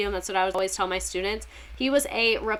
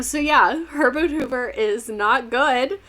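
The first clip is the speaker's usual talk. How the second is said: ordinary delivery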